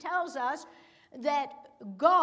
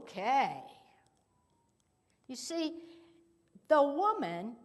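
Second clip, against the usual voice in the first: ordinary delivery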